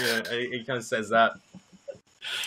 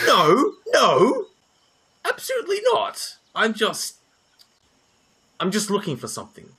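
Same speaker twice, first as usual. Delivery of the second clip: much higher, squeaky, flustered no; flustered denial; 10 semitones higher than usual. Acted. Raylan flustered, denying problems to Virgil, high panicked squeak. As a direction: deny it in a panic, then rush.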